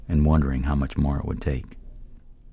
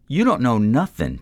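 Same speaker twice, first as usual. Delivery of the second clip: sharp angry outburst, higher and louder; lashing out; 9 semitones higher, 5 dB louder than usual. A narrator's reading. Man lashing out at the stranger, angry. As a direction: snap back hard at the stranger. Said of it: "Troy lashed out".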